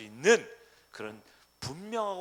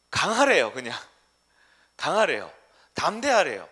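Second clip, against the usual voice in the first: louder, emphatic preaching; forceful proclamation; 3 semitones higher, 12 dB louder than usual. Acted. Pastor proclaiming God's orders to the church, loud. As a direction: ring out each order.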